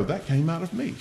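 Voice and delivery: soft, startled murmur to oneself; as if caught off guard by your own amen; surprised aside. Retold or imitated. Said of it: "And you go".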